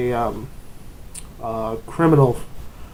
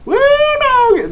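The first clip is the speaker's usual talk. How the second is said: high, loud hillbilly whoop; yelped Southern hoot; 5 dB louder than usual. Retold or imitated. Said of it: "they haven't been like".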